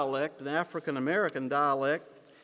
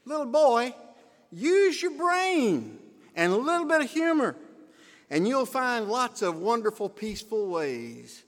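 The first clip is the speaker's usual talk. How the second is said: put-on high-pitched, emphatic lesson to a child; storybook moral; 5 semitones higher than usual. Storyteller giving a little boy the lesson, high and emphatic. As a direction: lift the voice high to deliver the moral.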